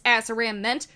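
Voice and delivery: plain reading voice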